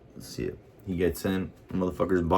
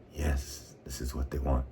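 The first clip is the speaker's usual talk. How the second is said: ordinary talk, nothing marked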